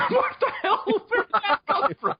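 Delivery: high-pitched, laughing outcry; laughing disbelief. Real, not acted. Player reacting to a surprise pipe detail, laughing in disbelief. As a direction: blurt it out through real laughter.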